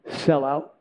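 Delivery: soft, low and hushed; a quiet word from above; quiet divine voice. Retold or imitated. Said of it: "I just heard this one word".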